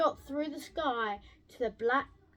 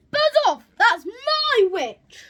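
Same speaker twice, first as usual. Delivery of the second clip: monstrous beast roar; 7 semitones higher, 9 dB louder than usual. A narrator's reading. Beast chases the dragon from the witch, bellowing. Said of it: "terrible voice when it started to speak".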